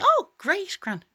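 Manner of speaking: fake cheerful. Shown as a demonstration. Coach shows the fake cheery answer to hide feelings. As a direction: paste on a breezy smile. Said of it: "And instead of, like, going".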